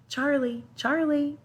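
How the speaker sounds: high, urgent call of a name. A narrator's reading. Boy bursts in shouting for the old man, calling out.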